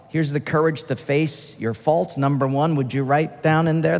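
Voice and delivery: normal talking voice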